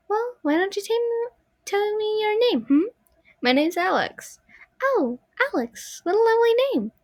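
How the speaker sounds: put-on high, light little girl puppet voice; a sweet small girl's voice, pitched high. Marionette asking the visitor's name in a high girl voice.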